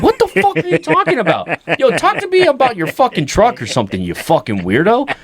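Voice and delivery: squeaky laughing what are you talking about; high incredulous outburst. Retold or imitated. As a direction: yelp it at your weird dad. Son retells reacting to dad.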